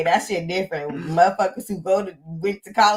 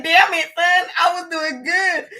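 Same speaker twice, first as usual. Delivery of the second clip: laughing while quoting herself; 10 semitones higher, slower than usual. Retold or imitated. Mother retelling how she scolded her crying son, laughing and high. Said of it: "I said".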